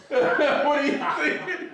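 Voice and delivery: high, loud, slowed-down demand; mock angry echo. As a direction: parrot the raging boss through laughter. Co-host mimics the angry boss.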